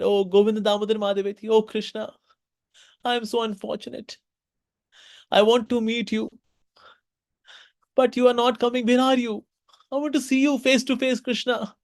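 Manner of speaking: high, pleading lament; desperate plea. Acted. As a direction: cry out to Krishna in longing. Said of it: "sat in desperation".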